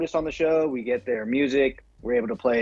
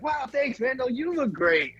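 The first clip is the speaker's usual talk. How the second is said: flattered stranger; 9 semitones higher, slower than usual. Retold or imitated. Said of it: "the guy's like".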